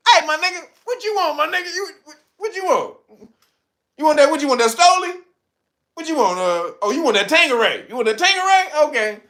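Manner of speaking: high, pushy bartender voice taking orders; mock bartender. Bartender asking a drunk sunfish what he wants, high and pushy.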